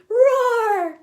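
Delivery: bright happy roar. Shown as a demonstration. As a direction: roar with delight. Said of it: "Can you roar happily".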